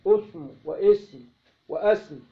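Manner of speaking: اسم said three ways, first vowel u, then a, then i; اسم with its first vowel cycled; vowel variants. Shown as a demonstration. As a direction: say اسم واسم واسم slowly, changing only the first vowel. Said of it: "بتثليث أولي".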